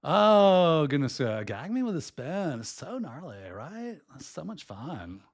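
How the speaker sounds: mock Valley Girl lilt; Valley Girl accent. Shown as a demonstration. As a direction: gush like an eighties Valley Girl. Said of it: "Go watch Valley Girl".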